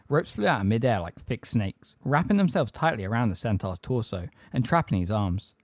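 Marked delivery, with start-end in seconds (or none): none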